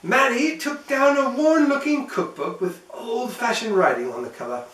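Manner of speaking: usual speaking voice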